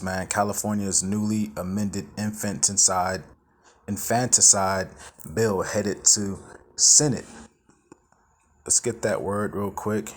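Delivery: normal talking voice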